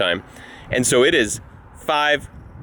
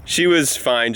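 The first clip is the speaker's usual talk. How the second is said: ordinary talk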